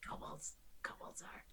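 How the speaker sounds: unvoiced hiss of a prompt; feed the answer in a whisper; a friend whispering the sacred answer.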